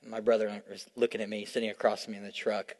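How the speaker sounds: low, soft storytelling voice; softly telling a sad story to the congregation; hushed sermon story. Acted. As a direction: draw them in with a quiet, tender retelling. Long story told softly.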